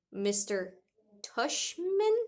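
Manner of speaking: high disbelieving rise on Tushman; a kid who can't believe the name; incredulous repeat. Acted. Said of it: "I repeated".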